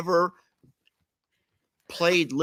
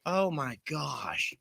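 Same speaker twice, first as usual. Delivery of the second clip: whisper; 9 dB quieter, slower than usual. Real, not acted. Embarrassed whisper aside.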